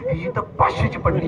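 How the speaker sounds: gleeful whisper. Acted. Woman gloats over other's loss.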